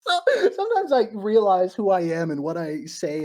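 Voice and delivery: loud, high laughing words. Real, not acted. Streamer laughing at her own holy water idea. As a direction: laugh at how silly you sound.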